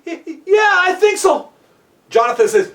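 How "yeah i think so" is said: high, shaken reply after a fright; scared. Acted. Walter hanging on after his slip, scared reply. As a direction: answer high and unsure, still frightened. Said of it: "He was, like, so scared".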